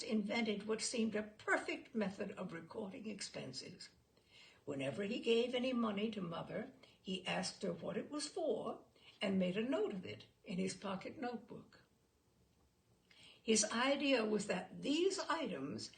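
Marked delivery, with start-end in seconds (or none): none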